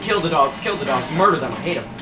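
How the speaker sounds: ordinary talk, nothing marked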